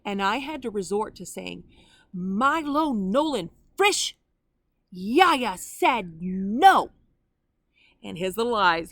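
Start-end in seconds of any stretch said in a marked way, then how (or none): 2.1-6.9 slow, loud, drawn-out full name, meaning business; stern grandma voice. Retold or imitated. Grandma warning her grandson at the pond, stern and loud. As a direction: call the toddler by his full name to stop him. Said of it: "by the tone of my voice".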